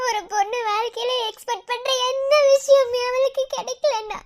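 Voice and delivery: usual speaking voice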